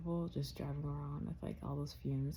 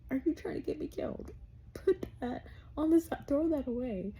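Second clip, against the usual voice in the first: fast, much higher, incredulous; panicked retort; 9 semitones higher, 4 dB louder than usual. Retold or imitated. Scolding her boyfriend about the sun visor, high and fast. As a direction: squeal it like he's trying to get you killed. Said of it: "and I'm like".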